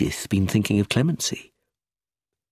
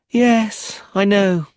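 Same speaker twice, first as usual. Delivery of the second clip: put-on very high little-girl voice, mildly bored; high, childish "old news" tone; 11 semitones higher, 5 dB louder, much slower than usual. A narrator's reading. Child hears of the arrest, still bored. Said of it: "still bored".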